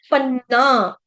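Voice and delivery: पन्ना with a held double न; geminated न in पन्ना; double consonant. Shown as a demonstration. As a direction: lean on the nn in पन्ना. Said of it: "पन्ना, double न".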